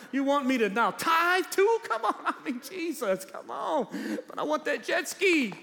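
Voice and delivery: high-pitched comic pleading with Jesus; exasperated protest. Acted. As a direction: complain upward as if the climb is too much. Acted whining protest up the mountain.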